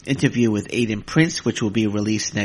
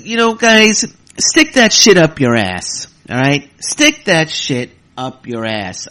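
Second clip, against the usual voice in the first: louder, heated cursing; angry outburst; 3 semitones higher, 7 dB louder, slower than usual. Real, not acted. Podcaster lashing out at Impact critics, angry loud rant. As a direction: let them have it, slow and hard. Ranting at critics, then apologizes.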